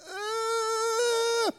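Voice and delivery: very high, loud squeaky yelp; a dog's quick excited yip; imitated dog yelp. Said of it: "and he goes".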